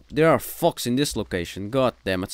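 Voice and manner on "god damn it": normal talking voice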